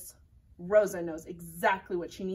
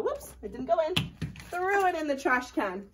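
ordinary delivery

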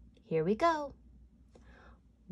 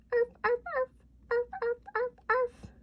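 tiny dog yaps; faster than usual. Acted. Dog noises in counting song.

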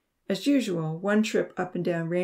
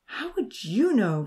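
high, slow, indignant challenge; mildly shouted, pitch jumping up; 6 semitones higher, slower than usual. A narrator's reading. Vahid challenging Odin over eating chickens, indignant shout. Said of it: "Vahid shouted".

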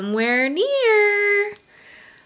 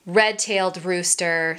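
ordinary delivery, nothing marked

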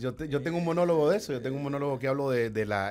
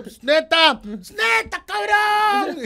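much higher, put-on Mexico City chilango accent; a cocky chilango heckler, pitched way up; chilango heckle; 7 dB louder, much slower than usual. Heckler shouting up at the ticket seller, chilango accent. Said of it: "ese hablado chilango".

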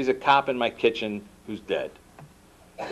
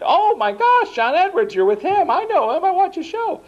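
gushing, much higher and louder, squealing with excitement; starstruck mom; 4 dB louder than usual. Retold or imitated. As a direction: play the mom who can't believe who's on the line. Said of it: "Mom answers the phone".

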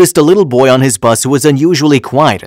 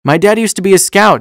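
put-on little boy; 6 semitones higher than usual. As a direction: voice an earnest little boy higher than the narration. Narrator voices the little boy.

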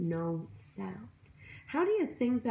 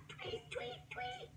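very high, soft tweet tweet; birdsong tweets; 16 dB quieter than usual. A narrator's reading. Morning birds wake the bunny, high soft tweets. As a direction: chirp it like a small bird. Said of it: "to the birds singing".

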